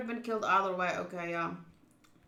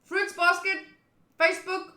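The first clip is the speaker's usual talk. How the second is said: excited shout; 11 semitones higher, 11 dB louder than usual. Real, not acted. Excited guessing game joke.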